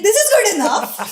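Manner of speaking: a giggled, pleased verdict; laughing self-approval. Retold or imitated. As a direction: chuckle as you approve your own pitch. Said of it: "I was like".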